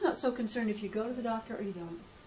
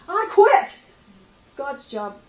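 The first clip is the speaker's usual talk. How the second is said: angry quitting shout; 2 semitones higher, 12 dB louder, slower than usual. Retold or imitated. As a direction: hurl it out in a temper tantrum. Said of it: "I was so mad".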